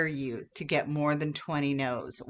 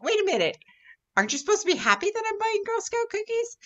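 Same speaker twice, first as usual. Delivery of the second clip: much higher and fast, puzzled; confused customer voiced high and quick; baffled customer; faster than usual. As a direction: sound thrown by an unexpected reaction.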